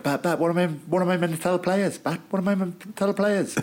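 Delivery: high-pitched, camp agitated protest; camp Alfie impression. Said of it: "That's quite a camp impression".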